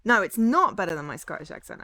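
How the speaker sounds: loud, emphatic denial leaning on "not"; stress on not. Real, not acted. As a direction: hit the word "not" hard in rebuttal. Rebuts chat on own accent question.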